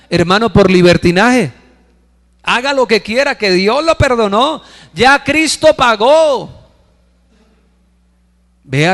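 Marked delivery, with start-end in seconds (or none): 2.4-6.7 high-pitched parody, last words drawn out; mocking sect preacher. Preacher imitating a sect that trades grace for licence, mocking. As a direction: mimic their doctrine in a raised, exaggerated voice. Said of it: "Esa secta demoníaca, hermano, que cambia la gracia".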